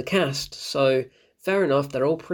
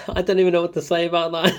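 laughing, flustered; 4 semitones higher, 4 dB louder, faster than usual. Real, not acted. Reacting to the terrible line.

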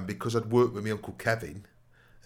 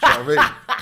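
bursts into laughter, high and loud; laughing; 13 dB louder, much faster than usual. Real, not acted. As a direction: laugh right through the question. Laughing at his own story.

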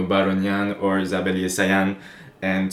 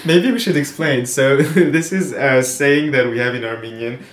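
laughing; 6 semitones higher, 7 dB louder than usual. Real, not acted. Laughing at the saying.

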